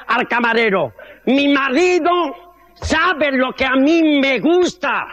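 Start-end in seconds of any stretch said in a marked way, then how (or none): 1.2-5.1 slow, drawn out and sweet; lightly voiced smug wife; slow coy wife. Wife orders for both.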